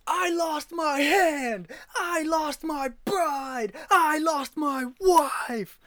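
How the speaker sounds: high, slow, wailing cry of loss; hammed-up Shakespearean lament. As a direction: wail it out like a tragic hero. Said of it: "that line was not delivered too far off than how you just delivered it".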